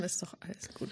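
soft whisper, fading out. Real, not acted. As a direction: whisper it warmly. Soft closing reassurance.

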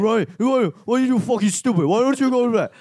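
high-pitched mocking caricature; exaggerated squawky mimicry of being mimicked; imitating an imitator. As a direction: do a bad, high imitation of yourself. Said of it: "I can do you doing me".

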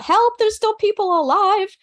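high-pitched call for help; retold holler. Survivor hollering to approaching rescuers, high retold holler. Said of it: "I just hollered out".

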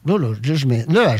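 ordinary talk, nothing marked

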